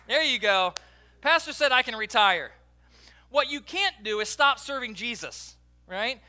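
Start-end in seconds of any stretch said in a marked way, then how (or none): none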